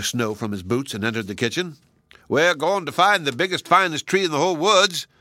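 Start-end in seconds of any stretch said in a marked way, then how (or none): 2.3-5.1 excited call. A narrator's reading. Toyman calling children.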